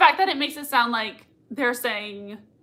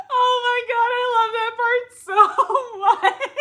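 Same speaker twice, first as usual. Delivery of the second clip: much higher, tearful, fast gush; overjoyed squeal; 11 semitones higher, 5 dB louder than usual. Real, not acted. Fan gushing to viewers about a beloved scene, tearful squeal. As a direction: gush as if about to cry with joy.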